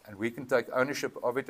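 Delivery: ordinary talk, nothing marked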